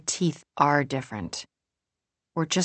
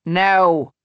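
loud, higher cut-in; interrupting; 4 semitones higher, 8 dB louder than usual. A narrator's reading. Molly stopping Julie's story, interrupting loudly. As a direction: cut them off with volume. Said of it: "Molly interrupts".

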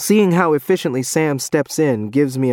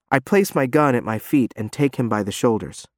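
usual speaking voice

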